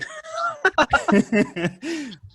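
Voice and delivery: a high burst of real laughter; real high laugh after the punchline. Real, not acted. Laughing at own story.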